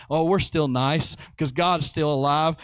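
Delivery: ordinary delivery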